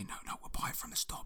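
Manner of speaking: fast, hushed whisper. Retold or imitated. To his wife in the quiet shop, fast whisper. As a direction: hiss it under your breath so staff don't hear. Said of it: "I was trying to say".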